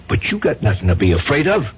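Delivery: ordinary talk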